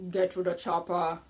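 put-on loud Austrian-accented movie line; Arnold Schwarzenegger accent. Reviewer quoting the game's title like Arnold, Austrian accent. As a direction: bark it out in a heavy Austrian accent. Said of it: "How many more times do I have to say it like this?".

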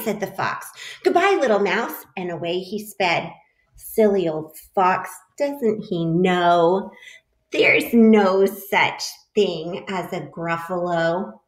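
lively picture-book read-aloud; animated storybook voice for a class; reading to children. A narrator's reading. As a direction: read it like story time for little kids.

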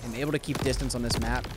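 ordinary talk, nothing marked

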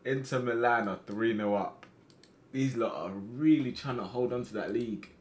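ordinary delivery

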